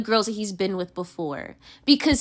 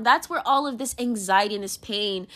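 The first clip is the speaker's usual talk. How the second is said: ordinary talk, nothing marked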